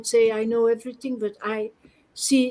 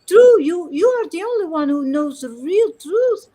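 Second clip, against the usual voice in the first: raised, higher, emphatic plea; half-shouted, fervent defence of the truth; retold impassioned shouting; 5 semitones higher, 4 dB louder than usual. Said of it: "he's surprised that we shout for that".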